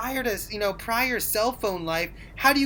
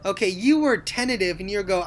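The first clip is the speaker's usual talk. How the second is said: normal talking voice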